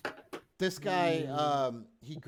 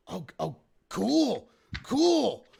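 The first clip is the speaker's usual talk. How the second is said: higher, louder, drawn-out dawning amazement; wide-eyed surprise; 8 semitones higher, 8 dB louder, much slower than usual. Acted. Game master voicing a wide-eyed hobgoblin, surprised, not afraid. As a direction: stretch out the cool, a touch awed. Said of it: "eyes kinda, like, go wide".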